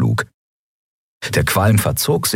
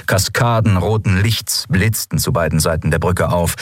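ordinary delivery

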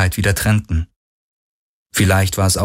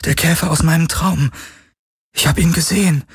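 high-pitched, alarmed insistence; frightened; 9 semitones higher than usual. A narrator's reading. Kirai tells Garland her nightmare is aboard, frightened. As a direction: insist fearfully on what was seen. Frightened woman reports dream creature.

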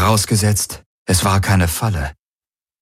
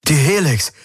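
exclaimed; 7 semitones higher than usual. A narrator's reading. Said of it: "rief Galen aus".

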